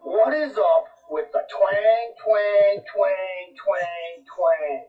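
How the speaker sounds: put-on slow, drawn-out twangy accent; hammed-up Southern twang; mock twang. Mocking country twang.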